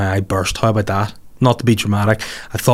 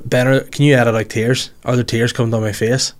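normal talking voice

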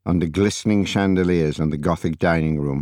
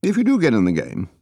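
put-on casual older spymaster; 9 semitones higher than usual. A narrator's reading. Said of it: "Then he said casually".

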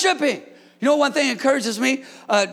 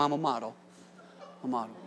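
much softer "I'm a model" said to a neighbor; telling your neighbor; 12 semitones lower, 16 dB quieter, much faster than usual. Shown as a demonstration. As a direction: lean over and quietly tell your neighbor "I'm a model". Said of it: "find somebody, man of God, and tell them".

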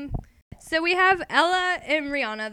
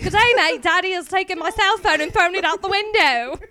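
high, loud, hammed-up Australian accent; badly faked Aussie accent drifting a bit British; 6 semitones higher, 9 dB louder than usual. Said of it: "What's an Australian accent?".

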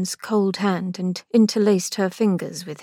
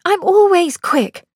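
higher, louder retort; Angelica, indignant; 10 semitones higher, 6 dB louder than usual. A narrator's reading. Sister retorting to brother, indignant. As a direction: snap back at the brother.

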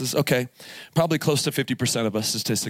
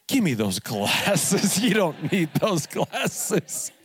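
slow, laughing mock demand; mock outrage, laughing; 5 semitones higher, much slower than usual. Dad jokingly demanding his daughter's glasses back, laughing. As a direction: pretend offence while cracking up.

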